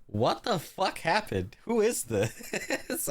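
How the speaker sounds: drawn-out, rising-pitched mock disbelief; acted bewilderment. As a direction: replay your baffled reaction, stretching the words. Acts out own earlier bewildered reaction.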